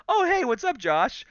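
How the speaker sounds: high, casual, smiling greeting; imitating a breezy hello, as if nothing happened; friendly switch. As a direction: flip to sweetly nonchalant. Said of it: "I turn to him and I just smile".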